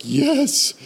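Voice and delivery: sudden high eager yes. Retold or imitated. Pastor accepting God's offer of a break, eager. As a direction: leap at the offer. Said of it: "And I'm like".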